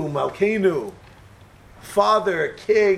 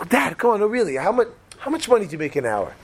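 put-on higher, pressing child nagging Dad; pestering kid, voice raised in pitch then quickening; insistent pleading; 5 semitones higher, faster than usual. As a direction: push the question, hurrying at the end. Kid insists, repeats the question.

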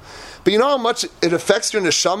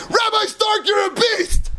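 much higher laughing mock shout; 11 semitones higher, slower than usual. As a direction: yell the compliment like an overexcited fan. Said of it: "he goes".

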